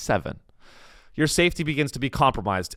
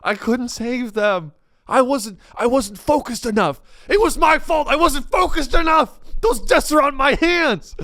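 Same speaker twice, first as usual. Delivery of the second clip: mock crying; 8 semitones higher than usual. Broken controller in fetal position, mock sobbing wail. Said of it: "crying and being like".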